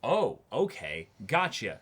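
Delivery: ordinary talk, nothing marked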